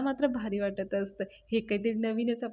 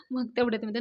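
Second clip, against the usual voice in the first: plain reading voice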